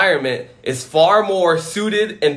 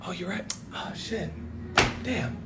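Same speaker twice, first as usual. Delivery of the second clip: much softer, hushed sarcastic agreement; hushed mock-guilty police voice; mock sheepish cop; 15 dB quieter than usual. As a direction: mutter like a caught-out official. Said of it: "And they're like".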